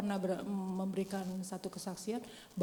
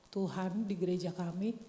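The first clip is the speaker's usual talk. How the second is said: ordinary talk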